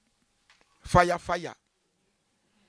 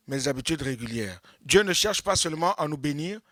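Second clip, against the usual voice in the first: normal talking voice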